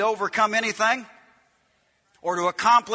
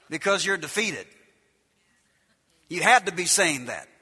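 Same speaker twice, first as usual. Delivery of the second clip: ordinary talk, nothing marked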